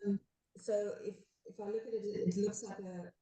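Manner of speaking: far from the mic, muffled; speaking away from the microphone, muffled. Real, not acted. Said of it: "kind of muffled".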